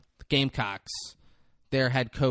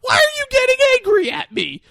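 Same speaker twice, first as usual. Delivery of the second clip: very high, loud wailing complaint; mock crying whine; 14 dB louder than usual. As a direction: whine it like a bawling overgrown kid. Character whines, near crying.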